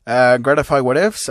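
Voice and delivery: ordinary delivery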